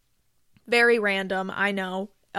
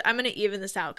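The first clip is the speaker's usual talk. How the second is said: normal talking voice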